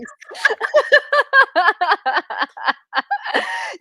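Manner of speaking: long, loud high-pitched cackle; loud laughter. Real, not acted. Host cracks up over goosebumps comment.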